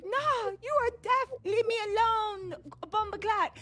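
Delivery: high, loud Jamaican patois, mocking; Jamaican accent. Jamaican lady telling off the doctor in a Jamaican accent. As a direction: shoo someone off in a Jamaican accent. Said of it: "speaking in a Jamaican accent".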